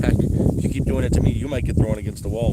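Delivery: loud stern telling-off of the dog; loud scolding. Real, not acted. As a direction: raise your voice at the jumping dog.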